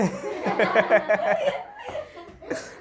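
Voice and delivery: an open, amused laugh. Real, not acted. Teacher laughing at the chachi mix-up, amused laugh. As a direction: let a real laugh out.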